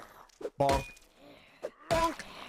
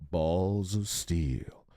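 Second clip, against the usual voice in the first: much lower, slow hero voice; deep macho catchphrase; 11 semitones lower, slower than usual. Retold or imitated. Quoting the game hero, slow deep macho voice. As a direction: drag it out like a tough guy.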